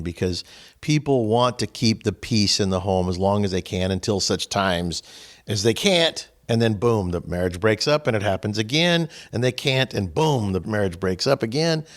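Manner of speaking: normal talking voice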